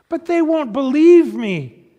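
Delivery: put-on a child sure no one will believe her; Lucy, distressed. A narrator's reading. Lucy protesting to Aslan, high and distressed.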